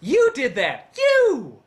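high, punched accusation, falling off; mock accusing shout. Critic blaming the viewers, mock accusing shout. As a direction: accuse them with a hammed-up shout.

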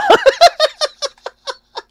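high, loud burst of laughter; real high laughing fit; helpless laugh. Real, not acted. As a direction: crack up in a high-pitched giggle. Laughing at the game.

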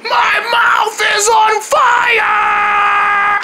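high, drawn-out wail; agonized scream. Acted. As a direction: stretch it into a long scream. Screams about burning mouth.

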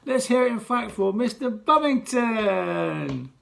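loud, slow, drawn-out host announcement; milk the crowd for applause; head teacher leading applause like a host; showbiz applause call. Acted.

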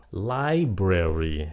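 LIBRARY slowly, as LI-bra-ry; stress on first syllable. Shown as a demonstration. Teacher models the word, slow LI-brary. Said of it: "nhấn âm một".

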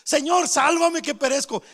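loud, urgent cry for rescue. Acted. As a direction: call out to the Lord as if sinking. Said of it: "comenzó a gritar". (grito de auxilio)

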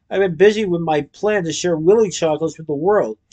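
plain reading voice

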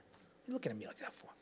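fast, soft, unvoiced grumble; grumbling back at a staring brother; mock angry mutter. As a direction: hiss it under the breath. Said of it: "My brother would look at me".

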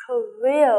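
Korea with the weight on RE; stress second syllable. Shown as a demonstration. Teacher drills Korea, stress on the second syllable. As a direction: lean on the middle of Korea. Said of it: "trọng âm thứ hai".

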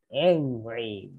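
low, mock-angry growl; acting angry. Shown as a demonstration. Teacher showing first level what angry means, mock angry. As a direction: say it low with pretend anger.

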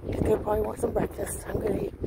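normal talking voice

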